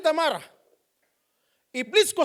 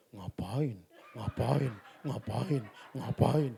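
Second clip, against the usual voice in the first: much lower, softer dismissive grumble; gruff husband; 12 dB quieter, much slower than usual. Acted. Husband brushing off his wife in a low, soft grumble.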